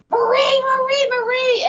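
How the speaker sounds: high, loud panicked screams of her name; screaming a name. Retold or imitated. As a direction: yell her name in terror, over and over. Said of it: "Joe was screaming my name".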